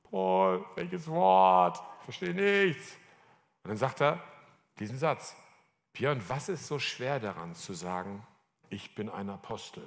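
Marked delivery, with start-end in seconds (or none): 0.1-3.0 drawn-out, protesting cry of confusion; hammed-up exasperated protest; exasperated bafflement. Acted bafflement at repeated demand. 5.9-9.8 hushed and tender retelling; gentle, sensitive. Retold or imitated. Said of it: "Das war so ein ganz sensibler Moment".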